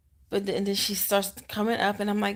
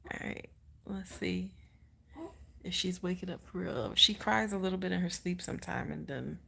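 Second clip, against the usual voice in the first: hushed aside; 7 dB quieter than usual. Real, not acted. Mother lowers her voice for her sleeping baby. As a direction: drop to a much softer hush.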